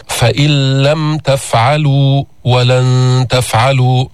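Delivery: فإن لم and ولن تفعلوا slow, the نون vanishing into the لام; slow Quran recitation. Shown as a demonstration. Teacher recites the full verse as a model, slowly. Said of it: "فإذا لفظنا الحرف الثاني مشددا".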